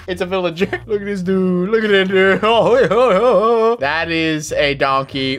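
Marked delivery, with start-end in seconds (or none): 0.7-3.8 laughing. Real, not acted. Player seeing his villager lookalike, laughing. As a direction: crack up while pointing it out.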